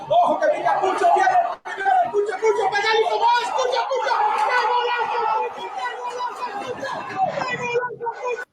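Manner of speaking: rapid, shouted radio goal call; breakneck goal commentary. Acted. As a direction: call the goal at full speed. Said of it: "cómo le canta los goles".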